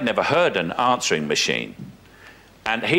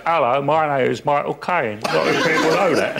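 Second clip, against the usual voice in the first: laughing, higher send-up of his own catchphrase; mock Michael Caine; 4 semitones higher than usual. Actor mocking impressionists who copy him. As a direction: ham it up like an impressionist. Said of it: "they put glasses on ... and they go".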